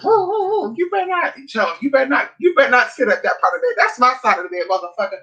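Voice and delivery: high yapping dog voice, then jealous scolding; hammed-up jealous dog voice; dog barking. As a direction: bark it out like a jealous little dog. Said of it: "he would bark".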